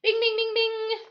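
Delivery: high buzzer bings; ding out quick high bings like a quiz buzzer. A narrator's reading. Narrator imitates the quiz buzzer, high bings.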